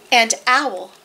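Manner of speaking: deliberate picture-book narration with vivid animal noises; story reading to children. A narrator's reading. Narrator tells children of sleepless Owl, deliberate expressive storytelling. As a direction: read to little ones, spacing out the refrain.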